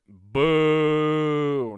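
a sudden loud, jeering boo. Real, not acted. Host booing the homemade cleaner tip, loud jeer. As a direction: boo the idea off the stage.

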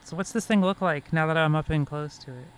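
usual speaking voice

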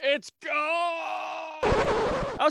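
high, loud, drawn-out wail; long theatrical holler; hammed-up anguished shout. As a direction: cry it out with full movie melodrama. Answers the quoted movie line.

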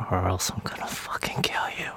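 low, hushed death threat in character; whispered mock threat. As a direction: whisper it like a playful menace. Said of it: "You committed to your character finally".

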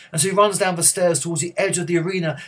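normal talking voice

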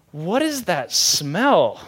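drawn-out, slow bewildered question; baffled disgust. Retold or imitated. Grandpa waking with cheese in his beard, baffled disgust. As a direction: stretch each word, mock-puzzled by a stink.

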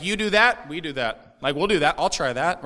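loud, breezy party voice; carefree millennial. Retold or imitated. Preacher mimics Mordecai and Esther as spring-breakers, loud and carefree.

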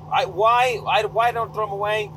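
fed up with yourself, pitch jumping right up; high, incredulous self-questioning; exasperated. Real, not acted. Exasperated at own hoarding habit.

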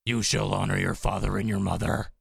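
heavy, hammed-up rock proclamation. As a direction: proclaim it like a metal singer. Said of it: "a Metallica voice".

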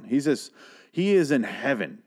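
ordinary delivery

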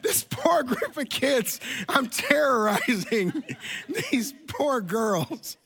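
high, slow words squeezed through laughter; real helpless laughter breaking up the sentence; laughing while talking. Real, not acted. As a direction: crack up at your own story, barely getting words out. Cracking up at his own story.

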